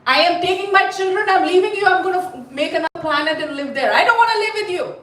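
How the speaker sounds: high-pitched, rushed angry rant; indignant wife. Acted. Wife telling husband she is leaving, acted indignant rant. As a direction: rattle it off, pitch climbing in outrage. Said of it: "Then she said".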